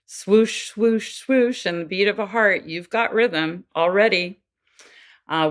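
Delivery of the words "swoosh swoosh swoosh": rhythmic whooshing like a heartbeat in the womb; imitating a heartbeat heard in the womb, rhythmic; heartbeat swoosh. Shown as a demonstration. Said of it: "nothing but heartbeat".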